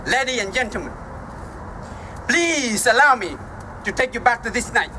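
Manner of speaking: ordinary talk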